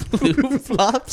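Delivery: high laughing disbelief. Real, not acted. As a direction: laugh it out, incredulous. Cracks up at alien's answer.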